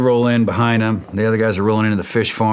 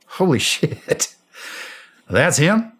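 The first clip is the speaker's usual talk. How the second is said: higher, slow, laughing disbelief; amazed laugh; 7 semitones higher, slower than usual. Retold or imitated. First sight of Saddam.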